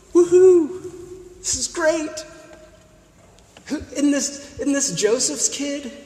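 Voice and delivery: high-pitched imitation of delighted townsfolk; happy crowd reaction. Preacher imitating townsfolk thrilled by the hometown preacher, happily excited. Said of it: "What makes the people happy?".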